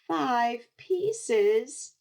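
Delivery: put-on high whiny child's complaint; whined. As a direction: whine it like a kid who hates candy. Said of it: "he whined".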